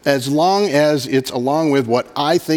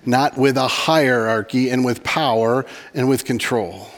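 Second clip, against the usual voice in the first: usual speaking voice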